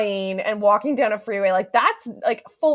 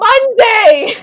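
sudden high, loud blurt; shouted confession; 13 semitones higher, 13 dB louder than usual. Real, not acted. Podcaster confessing a public meltdown to co-host, shouted. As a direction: shout it out at much higher pitch.

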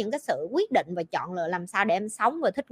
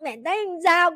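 higher, asking for praise through tears; a crying girl pleased with herself; a lightly voiced teary daughter; teary proud child; 6 semitones higher than usual. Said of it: "kiểu vẫn còn khóc nhưng mà kiểu sướng".

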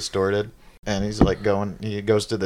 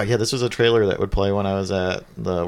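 usual speaking voice

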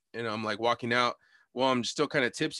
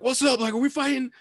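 high, loud eager challenge; fired-up challenge; 12 semitones higher, 4 dB louder than usual. Retold or imitated. Friend comes around asking about a fight, fired up. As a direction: jump in pitched way up, ready to fight.

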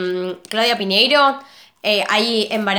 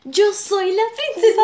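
voz aguda de princesa, cantarina; cítala como princesa de cuento; imitando a Rapunzel; 9 semitones higher than usual. Retold or imitated. Cita de Rapunzel actuada. (imitando a Rapunzel con voz aguda)

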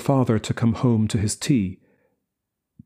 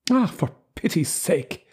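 higher exasperated cry; plead, worn out; cried out; 7 semitones higher than usual. A narrator's reading. Mother cries out to father, exasperated. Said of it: "she cried".

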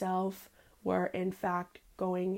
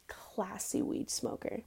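slow whispered and hushed; classy whisper; 9 dB quieter, slower than usual. Acted. She claims to be a classy smoker, in a slow whisper. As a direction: whisper it like a secret luxury.

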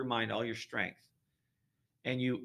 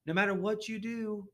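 lightly put-on Southern accent, pitched higher; a hint of Southern televangelist; Southern preacher voice; 7 semitones higher than usual. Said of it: "I'm using kinda my little Southern voice".

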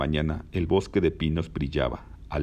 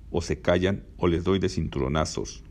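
plain reading voice

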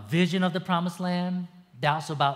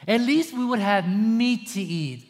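louder, higher mock whining complaint; hammed-up whining grumble; complaining Israelites; 5 semitones higher, 5 dB louder than usual. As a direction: moan like a sulky crowd. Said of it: "they start to say".

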